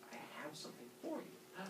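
very soft, secretive offer; hushed, teasing character voice; coaxing Uranus. Acted. Uranus lures Kronos with present.

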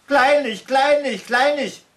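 high, loud crowing bird voice. A narrator's reading. Solvik crows the word it likes, high and loud. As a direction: crow the word like a cheeky bird. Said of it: "krähte Solvik".

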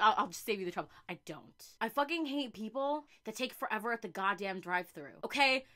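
normal talking voice

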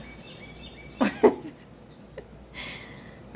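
long, high giggle; laugh. Real, not acted. Joking to viewers that animals visit her, a laugh. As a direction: let out a pleased, breathy giggle. Laughs at own bear remark.

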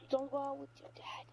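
normal talking voice

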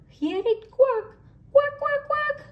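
high, playful quack quack. Acted. Narrator showing the duck to children, high playful quacks.

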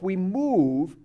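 ordinary talk, nothing marked

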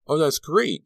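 high, loud exclamation of relief; delighted cry. A narrator's reading. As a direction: cry out at the good news. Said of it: "Carrie cried".

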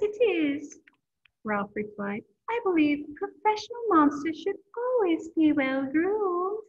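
lively storybook read-aloud voice; reading to children. A narrator's reading. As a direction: read it like story time for kids. Reading a picture book aloud.

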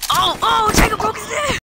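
high panicked yelling; panicking gamer. Real, not acted. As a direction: yell it out in sudden alarm. Teammate dies mid-round, shouting.

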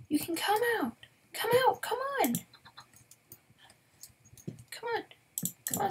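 high coaxing to a chicken; coaxing call. Real, not acted. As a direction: lure a pet with a high voice.

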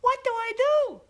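crying creature. Acted. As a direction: wail it like a lost frightened creature. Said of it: "the creature cried".

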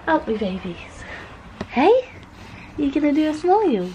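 high, coaxing parent voice. Real, not acted. Mother coaxing babies to smile for the camera, playful baby talk.

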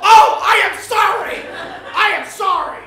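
loud, high, overdone gasping apology for a slip; mock horrified apology. Swearing on morning TV, apologizes in a loud mock panic. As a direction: ham up the shocked oh and the sorry.

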